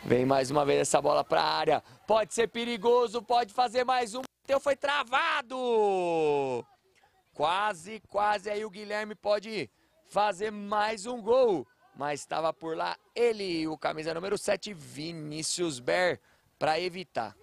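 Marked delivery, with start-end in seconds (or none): 2.1-11.7 excited commentary. Real, not acted. Dangerous chance near goal.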